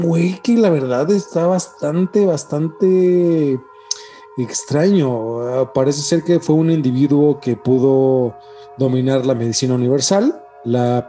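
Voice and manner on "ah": normal talking voice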